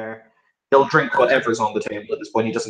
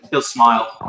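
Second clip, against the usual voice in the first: normal talking voice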